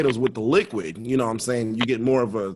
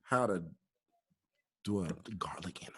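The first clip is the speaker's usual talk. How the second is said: hushed whisper, dropping to almost nothing; 5 semitones lower, 8 dB quieter than usual. Real, not acted. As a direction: whisper it like a secret. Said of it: "Why are you whispering?".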